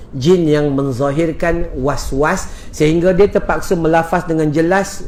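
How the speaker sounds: ordinary talk, nothing marked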